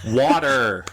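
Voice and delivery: loud, Americanized "water" as "wadder"; exaggerated American water. Gives in to confused waiter.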